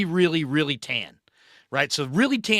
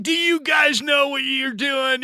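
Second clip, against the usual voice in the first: much higher, louder scolding yell; angry shout; 12 semitones higher, 5 dB louder than usual. Retold or imitated. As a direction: scold the careless college kids. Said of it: "He's like".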